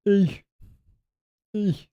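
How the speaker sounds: high falling seal call; seal bark. Retold or imitated. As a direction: squeak it like a seal. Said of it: "fart noise that it makes".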